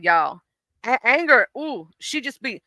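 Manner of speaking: usual speaking voice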